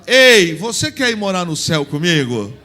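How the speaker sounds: big flirty shout of an invitation; loud playful invitation. Shown as a demonstration. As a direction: call it out boldly to your partner. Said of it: "fala com uma voz assim".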